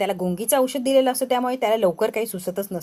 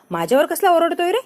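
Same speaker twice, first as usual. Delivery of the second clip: indignant complaint; 6 semitones higher, 5 dB louder than usual. Acted. Wife complains he's shouting.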